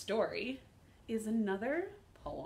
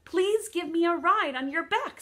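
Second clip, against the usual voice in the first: lightly acted, higher-pitched plea; pleading; 10 semitones higher, 10 dB louder than usual. Duck asking kangaroo for a ride, mildly pleading. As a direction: beg like an eager storybook duck.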